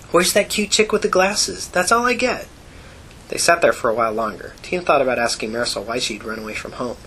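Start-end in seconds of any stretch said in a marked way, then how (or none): 0.1-2.5 high, slow teasing imitation; squeaky mock admirer voice. Marisol mimics people's comments.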